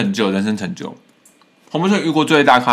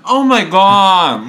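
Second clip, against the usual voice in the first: surprised; 7 semitones higher, 5 dB louder, much slower than usual. Real, not acted. Reacts to being recognised.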